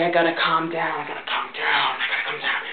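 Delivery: fading from low to a whisper; calming down, fading. Real, not acted. Vlogger calming herself, fading to a whisper. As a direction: talk yourself down to a whisper. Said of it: "Breathe, [name]".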